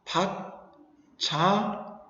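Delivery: 받자 drawn out very slowly; slow demo reading. Shown as a demonstration. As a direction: say 받자 slowly, syllable by syllable. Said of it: "요것도 없애면". (받자를 아주 천천히 끌어 읽기)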